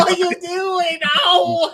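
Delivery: hammed-up high panicked shout of a plea; mock terrified hostage. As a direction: shriek it like a cowering hostage begging for mercy. Mocking the fake hostage act.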